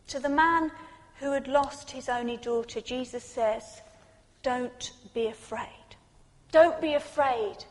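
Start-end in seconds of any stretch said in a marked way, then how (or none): 4.4-5.9 softer, slow and gentle; hushed comfort; soft reassurance. Acted. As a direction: say it like a hand on the shoulder. Repeated Jesus line, soft take.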